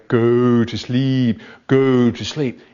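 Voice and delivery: slow, droning spooky chant; spooky hypnotist. Acted. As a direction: lull him to sleep with a spooky drone. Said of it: "this spooky voice".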